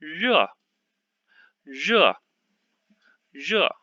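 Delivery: re sharply falling as a fourth tone; teacher drilling re, fourth tone; modelled Mandarin syllable. Shown as a demonstration. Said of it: "which one is the pinyin of".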